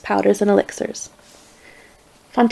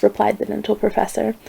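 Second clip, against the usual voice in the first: usual speaking voice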